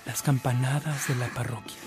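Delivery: ordinary delivery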